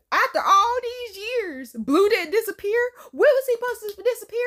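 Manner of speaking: high, loud disbelief; incredulous questioning. Real, not acted. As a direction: challenge it in worked-up bafflement. Disputing the movie's logic.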